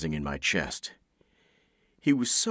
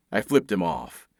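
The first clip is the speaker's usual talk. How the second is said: plain reading voice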